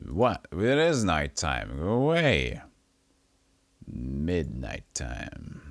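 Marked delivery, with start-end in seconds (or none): 3.9-5.6 slow spooky voice. Pretend. Slow spooky drawn-out line.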